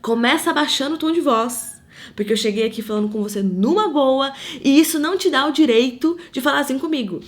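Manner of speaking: the flesh's angry answer to a woman shouting at her; indignant retort. Retold or imitated. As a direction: put her firmly in her place. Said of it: "o que a minha carne queria dizer".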